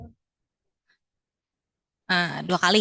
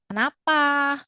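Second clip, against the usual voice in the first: raised, pleading questioning tone; expressive question; 5 semitones higher, slower than usual. Shown as a demonstration. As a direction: ask it with a worried, almost tearful face. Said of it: "Jangan lupa pakai ekspresi ya". (pertanyaan dengan ekspresi cemas)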